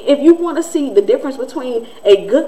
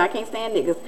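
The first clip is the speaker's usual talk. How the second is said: much softer retold aside; confided admission; 2 semitones lower, 8 dB quieter than usual. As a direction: repeat it hushed, imitating a confidant. Repeats neighbor's confided remark.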